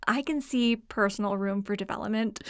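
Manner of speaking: usual speaking voice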